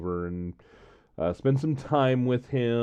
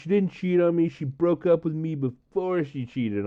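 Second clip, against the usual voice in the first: friend's voice; 7 semitones higher than usual. Retold or imitated. Friend argues back, voiced.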